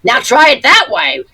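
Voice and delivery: loud, high mock challenge; impersonated mischievous actress. Veteran actress pranking a castmate before a take, loud impersonated teasing. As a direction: play the old trouper egging someone on. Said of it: "and she'd go".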